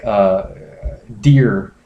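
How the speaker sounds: normal talking voice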